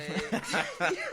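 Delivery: big burst of laughter; loud laugh. Real, not acted. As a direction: crack up loudly. Laughing at his own joke.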